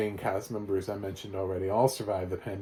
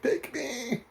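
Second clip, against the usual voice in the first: much higher, slow, crying Italian-style plea of love; pleading lovesick cry; 4 dB louder, much slower than usual. Retold or imitated. Wallace Shawn pleading for Candice Bergen, hammed-up crying plea. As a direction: wail it like a desperate suitor. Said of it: "pop up in Italy and be like".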